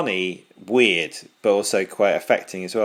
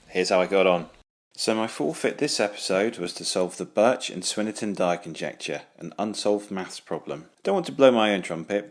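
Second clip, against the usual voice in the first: voiceover narration; 2 semitones lower, 4 dB quieter than usual. Acted. Voiceover for his forfeit video.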